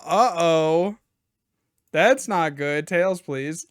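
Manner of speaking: usual speaking voice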